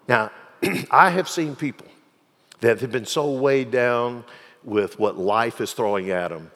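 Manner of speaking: normal talking voice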